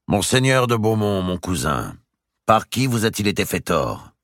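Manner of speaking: a grave, measured royal question; grave king. A narrator's reading. The king gravely asking Robert his grievance. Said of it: "demanda gravement".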